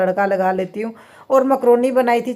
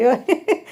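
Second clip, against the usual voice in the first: laugh; 6 semitones higher than usual. Real, not acted. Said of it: "इतनी हंसी आ रही थी ना".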